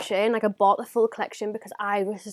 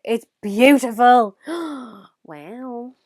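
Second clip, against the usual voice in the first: slow, loud gushing; loud swoon; 8 dB louder, much slower than usual. Real, not acted. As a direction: drag it out adoringly. Swooning, voice going.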